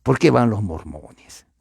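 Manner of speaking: usual speaking voice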